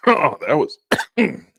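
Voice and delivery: choking, words broken by coughs; coughing up water. Real, not acted. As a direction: talk through a coughing fit after water. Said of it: "That went down the wrong throat".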